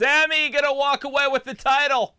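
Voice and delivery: excited. Real, not acted. Excited cheering for Sami.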